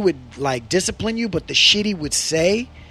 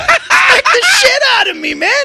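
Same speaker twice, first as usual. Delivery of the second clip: laughing hard; 8 dB louder than usual. Real, not acted. Laughing retelling the slap.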